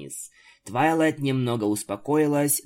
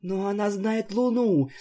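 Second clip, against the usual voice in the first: put-on higher, unsure little girl squeak; a timid child voice, pitched up; 8 semitones higher than usual. A narrator's reading. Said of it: "неуверенно пропищала маленькая Альфа".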